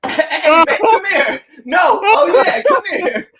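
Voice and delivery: high, loud call cracking into laughter; playfully beckon, helpless with giggles; joking come-on through squealing laughter; laughing mock summons. Acting out the joke while laughing.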